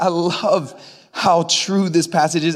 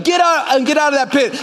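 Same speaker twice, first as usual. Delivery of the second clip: commanding shout; 7 semitones higher than usual. Acted.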